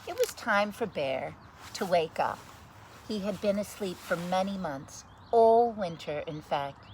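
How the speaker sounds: put-on lively read-aloud voice for children; story narration. Reading a picture book to children, lively story narration. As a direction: read it like bedtime story time.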